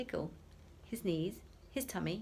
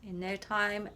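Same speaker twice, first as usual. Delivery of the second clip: plain reading voice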